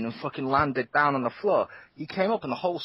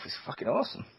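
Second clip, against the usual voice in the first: whispered; 6 semitones higher, 6 dB quieter, faster than usual. Real, not acted. Hyped about golf games.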